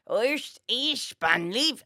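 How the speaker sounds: German dialect coach. Acted. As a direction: model German sounds like a coach. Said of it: "like, a dialect coach worked with Tom Cruise".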